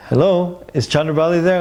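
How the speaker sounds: high, eager phone greeting; enthusiastic. Retold or imitated. Young devotee phoning his prospective bride, enthusiastic. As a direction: imitate your keen young self phoning up. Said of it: "Then immediately all enthusiastic".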